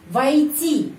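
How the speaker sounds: "войти" said high and loud, falling at the end; word stress demo. Shown as a demonstration. Teacher shows where the stress falls, emphatic "войти". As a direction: model "войти" with a clear stressed syllable. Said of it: "penekanannya di sini".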